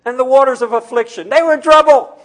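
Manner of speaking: loud, high, ringing preacher's cry; preaching at full force. Acted. Pastor exhorting the congregation in a loud, high preaching voice. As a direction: preach it out hard to the pews.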